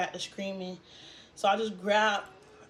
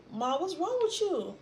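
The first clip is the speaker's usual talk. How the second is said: worried questioning; 9 semitones higher than usual. Retold or imitated. Daughter asking her crying mother what is wrong, high worried question. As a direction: ask mom what's wrong, voice rising high.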